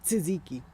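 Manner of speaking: tzatziki said as "sih-ZEE-kee", T and Z run together into one sound; tzatziki starting on a soft "ts", first syllable barely there; tzatziki with TZ blended. Shown as a demonstration. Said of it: "put the T and Z together".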